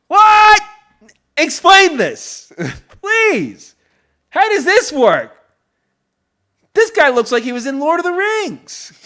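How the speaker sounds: high, loud comic outrage; incredulous shrieking. Real, not acted. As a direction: wail in exaggerated disbelief. Comic outrage at the actress's husband.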